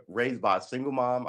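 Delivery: ordinary talk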